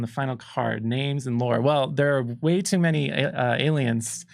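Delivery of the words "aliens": normal talking voice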